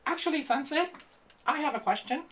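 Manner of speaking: very high squeaky puppet voice. Acted. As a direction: pipe it up like a ventriloquist's dummy. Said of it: "you have this really high-pitched voice".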